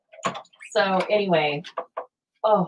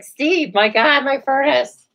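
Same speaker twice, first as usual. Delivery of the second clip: higher and louder, panicked plea; rattled panic; 8 semitones higher, 6 dB louder, slower than usual. Retold or imitated. Calling the furnace guy about the dead furnace, panicked and high. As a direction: blurt it out like the house is freezing. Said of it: "I'm nothing but rattle".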